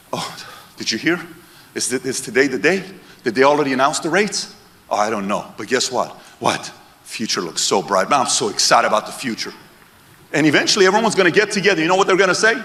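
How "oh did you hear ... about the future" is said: low, soft, unbothered reply; lightly voiced laid-back guy who isn't worried; calm long-term thinker. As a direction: lower and softer, relaxed and easy. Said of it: "you'll walk around the room like this".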